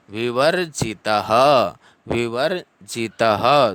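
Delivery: विवर्जितः ending in a light ha; visarga as ha. Shown as a demonstration. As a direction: finish विवर्जितः with ha, slowly. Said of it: "उसके बाद में शब्द आ रहा है".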